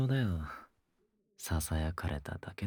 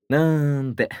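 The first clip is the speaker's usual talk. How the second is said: teasing laugh; 6 semitones higher, 12 dB louder, slower than usual. Acted. Teasing laugh after a boast.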